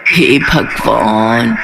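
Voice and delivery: slow, low, weary "oh God"; exasperated sigh. Acted. Mother-in-law despairing over daughters-in-law fighting, drawn-out weary sigh.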